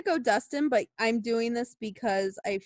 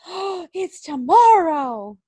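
mock surprise; 6 semitones higher, 6 dB louder, slower than usual. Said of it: "But now it's like".

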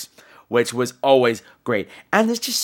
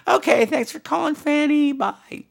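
high cheery sign-off; 13 semitones higher than usual. Real, not acted.

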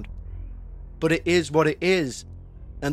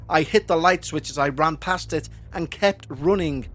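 ordinary delivery

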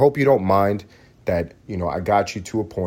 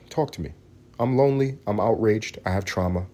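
ordinary talk, nothing marked

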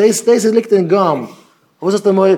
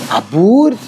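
mock disbelief; 4 dB louder, much slower than usual. Incredulous beard question.

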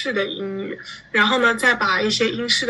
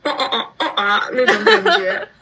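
hammed-up posh British noises, higher and louder; mock posh British; 6 semitones higher, 4 dB louder than usual. Mocking British English to the interviewer. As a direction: lampoon a posh British accent with a laugh. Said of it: "像那种British那样子的".

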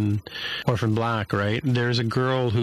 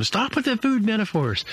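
much higher, exasperated outburst; mock yell; 12 semitones higher than usual. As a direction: snap at the therapist to stop. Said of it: "she yells".

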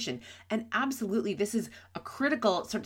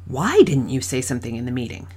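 "Why" hit first and strongest, rest lower; demanding WHY, then let the rest fall; stress on WHY; 6 semitones lower, 9 dB louder than usual. Shown as a demonstration. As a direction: open the question by punching "Why". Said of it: "Let's listen in a few different ways".

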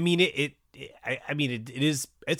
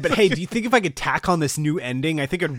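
much louder and higher praise; mock eager Dawson; 5 semitones higher, 9 dB louder than usual. Dawson praising his dad's speech, loud and eager. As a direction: gush before suggesting changes.

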